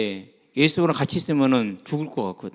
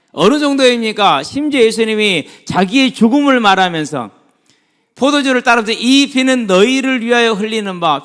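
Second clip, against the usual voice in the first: high, loud sermon voice; raised voice, pressing every phrase; forceful preaching; 9 semitones higher, 8 dB louder than usual. Real, not acted. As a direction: declaim it to the congregation.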